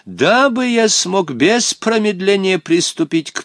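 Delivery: high, slow ornate pleading; impatient Hottabych. A narrator's reading. Old companion eager to seek his brother, impatient. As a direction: press them in flowery old-man speech. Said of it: "нетерпеливо предложил Хаттабыч".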